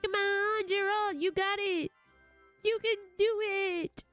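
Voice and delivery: root for your fighter in a high voice; high-pitched encouragement, not shouted; cheering on. Acted. Cheering on game fighter Gerald.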